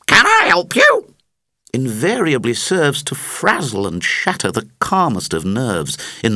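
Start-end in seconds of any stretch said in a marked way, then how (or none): 0.1-1.1 much higher and louder, a shrill bird's cry; parrot screech. Acted. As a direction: screech the offer of help like a parrot. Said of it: "to screech".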